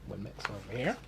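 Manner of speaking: ordinary delivery, nothing marked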